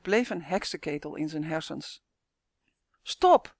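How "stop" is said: sudden high, loud yell; scream. A narrator's reading. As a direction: yell it out sharply in one burst. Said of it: "gilde hij".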